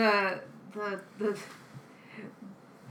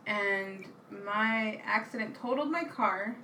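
usual speaking voice

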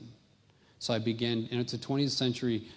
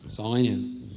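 usual speaking voice

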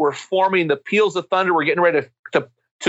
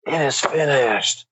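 weary cry of relief; slower than usual. Shown as a demonstration. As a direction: let it out like an exhausted man relieved it's over. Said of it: "weary cry of relief".

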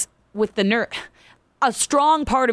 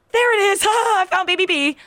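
high, louder relieved exclamation with a laugh; imitating the tech's laughing relief; relieved excited burst; 10 semitones higher, 3 dB louder than usual. Tech finally finds second baby.

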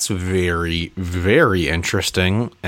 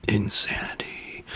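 breathy whispered repeat of one word; whispered echo; 5 dB quieter than usual. Real, not acted. Echoing the host's word in a hushed whisper. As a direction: whisper it back like a spooky echo.

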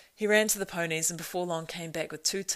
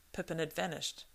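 ordinary delivery, nothing marked

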